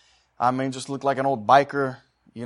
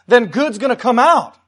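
impassioned preaching; 10 semitones higher, 11 dB louder than usual. Acted. Preacher urging people to fill up with good, impassioned preaching. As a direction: preach it out forcefully to the congregation.

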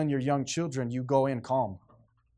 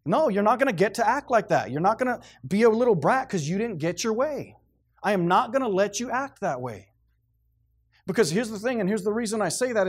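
high, louder fervent preaching; 6 semitones higher, 3 dB louder than usual. Acted. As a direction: drive the point home to the congregation. Preaching with full force.

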